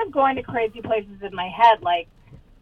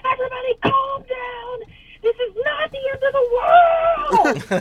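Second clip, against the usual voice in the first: retold scream; 3 dB louder, slower than usual. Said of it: "just screaming".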